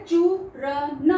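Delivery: चूरन said very slowly; slow drawn out. Shown as a demonstration. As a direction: stretch चूरन out for the class. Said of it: "इसकी long sound". (चूरन को धीरे खींचकर)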